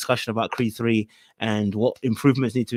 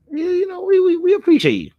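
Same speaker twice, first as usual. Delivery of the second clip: squeaky high voice; 5 dB louder than usual. Acted.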